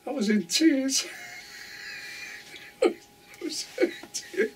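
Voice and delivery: high voice breaking up into laughs; real laughter. Real, not acted. Telling viewers about the funny film, cracking up laughing. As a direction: laugh until the words fall apart.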